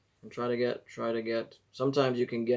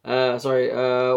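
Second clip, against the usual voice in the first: ordinary delivery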